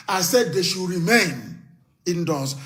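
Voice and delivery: normal talking voice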